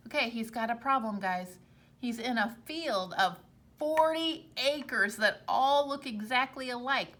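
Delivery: plain reading voice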